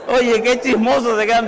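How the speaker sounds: laughing, loud teasing of the nosy crowd; laughing tease. Real, not acted. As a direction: tease them through laughter. Teases congregation while laughing.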